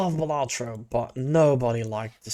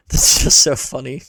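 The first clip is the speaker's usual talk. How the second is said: ordinary talk, nothing marked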